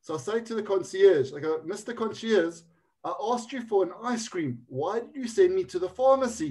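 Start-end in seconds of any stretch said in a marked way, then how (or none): 1.7-6.5 indignant complaint. Retold or imitated. Retelling complaint to hotel concierge.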